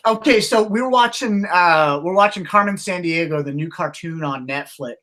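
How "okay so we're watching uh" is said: ordinary talk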